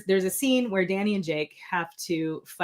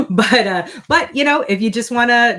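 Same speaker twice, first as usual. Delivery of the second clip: laughing; 6 semitones higher, 8 dB louder than usual. Real, not acted. Laughs at own sales pitch.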